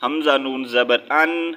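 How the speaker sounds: ان ending in a clear N, no ghunna; clear izhar. Shown as a demonstration. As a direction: sound the noon of ان plainly, without nasal hold. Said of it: "ظاہر کر کے پڑھیں گے". (ان کا نون صاف ظاہر کر کے)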